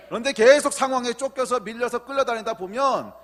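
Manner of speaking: high, loud question and falling, hammered answer; full-force preaching. Real, not acted. Preacher asks congregation what to do in each crisis, fervent and loud. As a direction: work the congregation up in call and answer.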